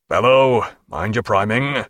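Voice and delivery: plain reading voice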